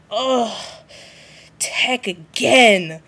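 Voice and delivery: slow, drawn-out annoyed ugh; exasperated groan. Pretend. The Volturi tired of Tech's pranks, slow exasperated groan. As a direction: sigh it out, fed up. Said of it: "The Volturi would just be like".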